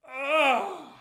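loud, high groan of frustration; loud exasperated "ugh". Real, not acted. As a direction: let out a big fed-up groan. Exasperated groan about his dad.

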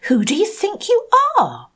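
haughty outburst. Acted. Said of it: "cried the Rainbow Fish".

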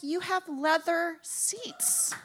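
very slow, solemn announcement; slow grave verdict. Retold or imitated. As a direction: spell out the bad news slowly. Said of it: "he's like".

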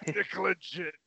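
booming, stretched-out fighter name; grand entrance announcement. Pretend. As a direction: shout the name to the arena. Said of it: "with the radio announcer's voice".

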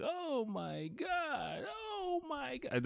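very high, slow, drawn-out wail; reenacted freak-out. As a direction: squeal it softly in helpless disbelief. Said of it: "you can just hear me like".

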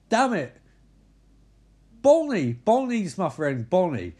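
exasperated. Retold or imitated. Katie exasperated naming her friend.

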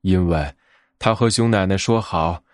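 ordinary delivery, nothing marked

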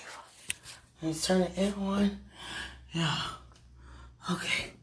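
ordinary delivery

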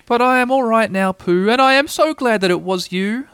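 small high voice, gladdened; high-voiced, relieved Piglet. A narrator's reading. Piglet glad it was Pooh, relieved. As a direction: speak high with evident relief.

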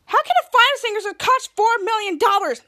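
mock incredulous protest; retold disbelief. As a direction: imitate a hero baffled by the price. Retold outrage at the price.

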